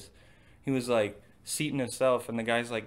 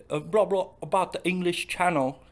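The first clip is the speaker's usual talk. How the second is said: higher, louder mock British commentator; mock British announcer; 6 semitones higher, 5 dB louder than usual. Mocking the announcer in a mock British accent. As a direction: parody British announcer babbling. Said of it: "terrible British accents".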